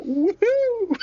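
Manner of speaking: high gleeful woohoo; cheering whoop. Retold or imitated. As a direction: whoop like a delighted character. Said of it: "Dickie's just like".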